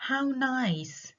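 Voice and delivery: high "How nice" that drops at the end; falling exclamation. Shown as a demonstration. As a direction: start "How nice" high, then let it fall. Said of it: "have falling intonation".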